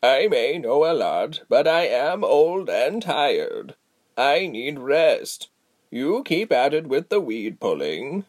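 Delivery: very slow, weary elder; tired old woman. Acted. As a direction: drag it out, bone-tired.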